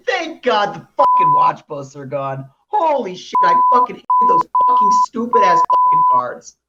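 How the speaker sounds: furious swearing outburst; angry rant. Real, not acted. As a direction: vent in full frustration. Rant about hated cards.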